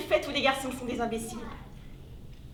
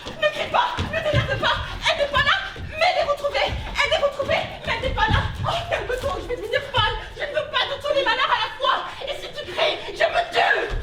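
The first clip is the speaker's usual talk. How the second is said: high, loud, desperate scolding; frantic high-pitched pleas for calm; frantic shouting; 9 semitones higher, 5 dB louder than usual. Acted. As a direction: shout over them, close to hysterical. Frantic quarrel, begging for calm.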